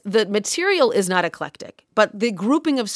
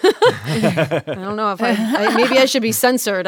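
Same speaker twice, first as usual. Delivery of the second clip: laughing; 4 dB louder, slower than usual. Real, not acted. Laughing self-consciously about content.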